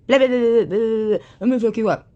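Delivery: high, loud mocking chatter; mocking blabber. Mocking the girl's rant to viewers, high and loud. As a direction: parrot her threats with scorn.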